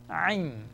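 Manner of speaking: Ain pressed out from a tight throat; Arabic letter sound demo; throat-squeezed ain. Shown as a demonstration. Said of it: "Contraction of your throat".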